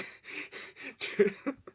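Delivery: breathy high burst of laughter; real laugh. Real, not acted. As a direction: laugh as the memories come back. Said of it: "I'm laughing because all the memories".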